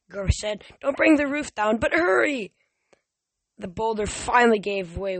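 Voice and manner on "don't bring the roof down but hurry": high, raised, urgent voice; worried urging, pitched up; urgent warning. Acted. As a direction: press them to move, rising in pitch. Grover urges Tyson while chased.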